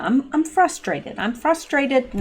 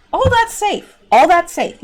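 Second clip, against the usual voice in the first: sarcastic; 7 semitones higher, 3 dB louder than usual. Real, not acted. Sarcastic repeat of safety claim.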